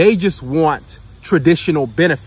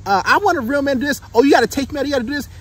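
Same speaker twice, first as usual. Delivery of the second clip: high, affected imitation of a woman; mock put-on act; 8 semitones higher, faster than usual. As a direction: pitch it up like a pretender playing demanding. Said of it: "wear a mask and act like".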